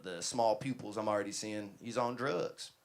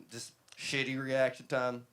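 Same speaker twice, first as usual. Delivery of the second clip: ordinary talk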